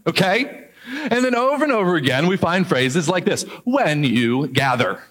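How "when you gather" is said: "when" stressed, said slowly. Shown as a demonstration. Pastor contrasting when with if, slowly. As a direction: lean on "when". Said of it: "Not if you gather, but when you gather".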